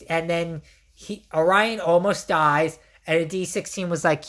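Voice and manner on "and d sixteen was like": ordinary talk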